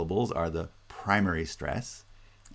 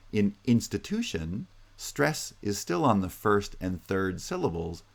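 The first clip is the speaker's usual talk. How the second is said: ordinary talk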